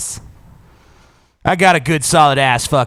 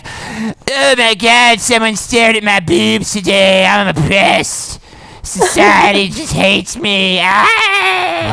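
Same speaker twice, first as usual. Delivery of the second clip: higher, slow mock whine; mock whiny complainer; 7 semitones higher, 3 dB louder, much slower than usual. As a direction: whine dramatically about being oppressed. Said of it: "the Tumblr feminist".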